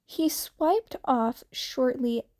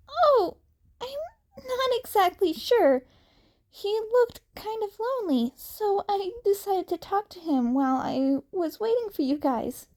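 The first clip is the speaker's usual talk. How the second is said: put-on higher, boyish voice, a little sheepish; sheepish young hero; 9 semitones higher than usual. Izuku explaining himself to Uraraka, sheepish and higher. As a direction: explain it like a kid caught being too friendly.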